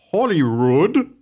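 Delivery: bad Scottish accent. Host reading history, trying a poor Scottish accent. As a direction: ham up a Scottish accent on "Holyrood", badly. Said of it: "That's the worst Scottish accent".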